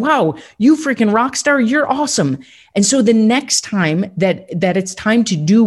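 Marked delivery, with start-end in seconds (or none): 0.0-2.4 cheering brain. Retold or imitated. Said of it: "they're going".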